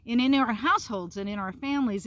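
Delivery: usual speaking voice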